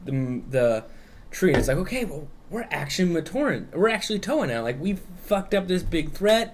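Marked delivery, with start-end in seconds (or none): 1.8-6.5 much higher, cocky boasting voice that peaks on cool; puffed-up bragging. Retold or imitated. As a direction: hype yourself up like a smug new hero. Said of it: "kind of puffing themselves up".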